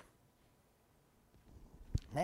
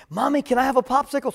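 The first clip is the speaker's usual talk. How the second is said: put-on higher, louder small-child plea; little kid asking; 7 semitones higher, 7 dB louder than usual. Toddler begging mommy for a popsicle, high little-kid voice. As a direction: ask sweetly like a toddler wanting a treat.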